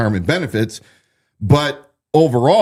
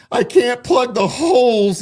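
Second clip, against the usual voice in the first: higher, drawn-out tearful imitation; mock crying; 10 semitones higher than usual. Host mimicking the sheriff's tearful outburst to the press, mock crying. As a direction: imitate a tearful, slow whine. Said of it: "And he's crying".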